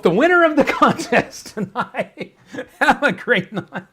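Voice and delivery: loud, high, breaking into laughter; laughing announcement. Real, not acted. Host jokingly declares himself the contest winner, laughing. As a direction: crow it through helpless laughter.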